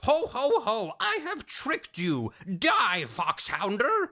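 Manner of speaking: high, slow villain taunt; hammed-up high evil scientist; gloating villain. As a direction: crow over the trap with a stagey ho-ho. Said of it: "But then he goes".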